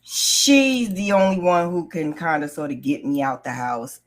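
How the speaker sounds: ordinary talk, nothing marked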